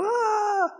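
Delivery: short high mock scream of a scared passenger; imitated scream. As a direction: yelp it like a terrified flyer. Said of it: "she's screaming and freaking out".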